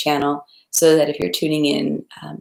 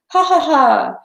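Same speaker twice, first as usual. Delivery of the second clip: high, loud spelled-out laugh; acted laughter; 10 semitones higher, 6 dB louder, slower than usual. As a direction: laugh out in delight at the hussar. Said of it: "the voices said".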